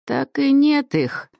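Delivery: high, slow chuckling crone; a wry little sneer of an old hag; smirking old woman. Acted. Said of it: "усмехнулась она".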